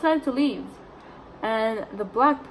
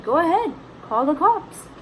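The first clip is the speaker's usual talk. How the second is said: high, louder retort in a retold quarrel; acted defiant dare; 9 semitones higher, 5 dB louder than usual. Mom answering the threat to call police, acted defiance. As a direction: dare them to do it, pitched well up.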